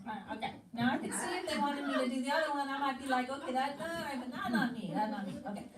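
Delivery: comic haggling with God; mock-grudging okay but not me; joking reluctance. Joking about other assignment.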